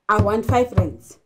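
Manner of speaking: put-on a blunt "I want five rands", the child demanding money; child demanding money from mom, blunt and rude; disrespectful demand. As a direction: demand it flatly, no please. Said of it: "That will be very disrespectful".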